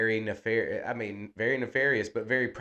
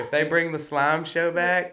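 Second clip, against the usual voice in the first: higher words laced with laughter; laughing, higher; 6 semitones higher, 4 dB louder than usual. Real, not acted. Still laughing about the slime show, a higher pitch through the laughter. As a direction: giggle through the line.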